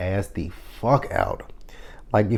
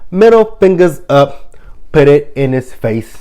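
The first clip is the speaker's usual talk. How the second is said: loud, higher and slow at first; hyped defiance; 5 semitones higher, 5 dB louder than usual. Pretend. Cheering the heroine's breakup, loud defiant hype. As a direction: shout it with middle fingers up. Hyping the dismissal.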